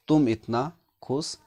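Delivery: ordinary delivery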